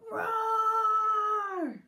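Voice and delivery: high, long, full-volume roar; loud roar. Acted. Children roaring at a mouse, loud roar. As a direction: everyone roars at once, big and long.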